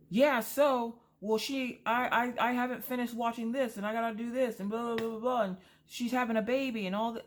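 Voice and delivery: high, fast whiny mock of the husband. Wife mimicking husband complaining about the YouTuber, high mocking whine. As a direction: squeak out his rambling complaint to make fun of it.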